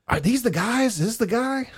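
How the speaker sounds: high, loud imitation of the bar guys; a lightly voiced eager barfly demanding answers; mock German regulars. Bar regulars crowding in, imitated high and loud.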